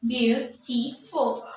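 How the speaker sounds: "Beautiful" said slowly, each syllable separated; syllables spelled out. Shown as a demonstration. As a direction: break "Beautiful" into its three syllables. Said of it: "Beautiful has how many syllables?".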